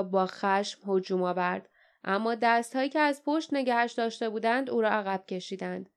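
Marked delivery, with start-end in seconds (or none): none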